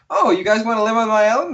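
high, quick, eager invitation; Tom Nook. Retold or imitated.

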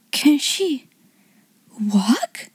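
breathless, halting wonder; mildly awed, breathy question. A narrator's reading. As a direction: ask it haltingly, pausing before the last word. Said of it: "she asked breathlessly".

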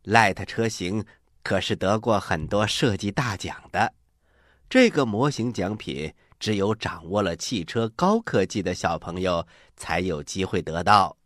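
ordinary talk, nothing marked

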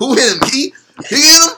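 high, loud mocking shout. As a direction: yell it like a confused drunk. Mocking the drunk friend.

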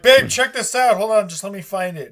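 grabbing his partner's attention, mock eager; eager call to babe. He calls his babe over to see a post, eager and high. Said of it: "I'm like".

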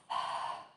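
long breathy exhale through the mouth; voiceless releasing sigh; audible sigh. Shown as a demonstration. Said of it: "Now sigh that breath out through the mouth".

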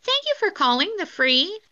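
high-pitched put-on operator voice; chat-line greeting. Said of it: "you have to say".

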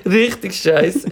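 slow drawn-out mock verdict; mock disgust. Real, not acted. Teasing cohost about favourite bar, drawn out. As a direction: drag it out, teasing.

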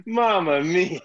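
stagey Italian exclamation; foreign accent. Acted. Playing a foreign persona for co-hosts, mock Italian accent. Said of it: "the accent that I'm gonna use".

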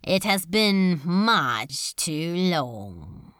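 slow, rasping, cold demon lord; rasping Beelzebub. Acted. Demon lord taunts Crowley after long absence, slow cold rasp. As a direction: draw it out like a cat with a mouse. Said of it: "the rasping, unpredictable accent".

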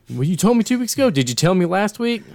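high, fast whiny boss voice; mock whiny boss. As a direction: fa la voce lagnosa del capo, acuta e veloce. Acting out the boss arguing.

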